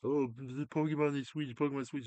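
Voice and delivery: high, loud, whining fan voice; mock whiny fan. Mocking fans who demanded Pokemon on Switch, high whine. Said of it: "be jerks and be like".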